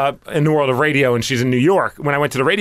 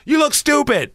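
quoting wife; 11 semitones higher than usual. Retold or imitated. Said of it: "when speaking about her husband".

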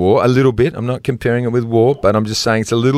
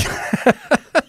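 a high, delighted laugh after the punchline; 14 semitones higher than usual. Real, not acted. Host laughing at the coup joke with co-host. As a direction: laugh at your own gag.

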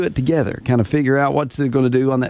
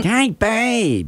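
put-on higher, sugary girlfriend coo; annoying girl voice; 7 semitones higher than usual. Shown as a demonstration. Host demoes the annoying girlfriend voice, cooing. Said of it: "that's an annoying girl voice".

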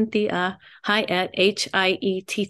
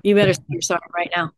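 lower, firm telling-off, quick at the end; stern scolding; 5 semitones lower than usual. Shown as a demonstration. Wife demonstrating how she scolds her husband, stern and firm. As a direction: scold him like a naughty child. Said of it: "when I do that and I say".

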